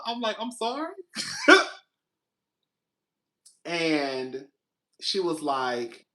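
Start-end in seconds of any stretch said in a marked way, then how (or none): 0.4-0.9 confused. Retold or imitated. Confused reply. 1.0-1.7 real laughing fit; loud high laugh. Real, not acted. Laugh after story beat.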